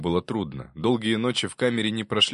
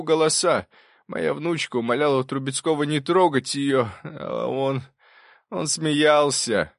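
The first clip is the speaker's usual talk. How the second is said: higher-pitched testimony, halting toward the end; professor confessing, distressed; 7 semitones higher, 3 dB louder than usual. Acted. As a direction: relive the painful scene, faltering before the last words. (взволнованная исповедь профессора, под конец с запинкой)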